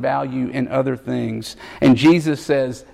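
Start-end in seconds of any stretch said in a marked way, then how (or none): none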